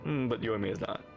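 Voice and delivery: ordinary delivery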